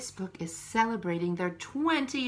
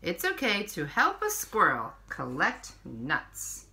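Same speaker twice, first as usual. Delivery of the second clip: put-on reading to children; 3 dB louder than usual. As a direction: read each page to little ones, unhurried. Reads picture book to children.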